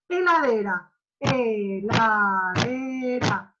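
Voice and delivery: "Heladera" split into beats, slowed; clapped syllables. Shown as a demonstration. Teacher to class, demonstrating syllable clapping. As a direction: tap out each beat of "Heladera". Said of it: "¿qué hago?".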